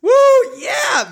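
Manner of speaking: whooping high and wild; rowdy party animal. Pretend. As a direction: cheer like a party crowd. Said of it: "ausgeschautet genug?".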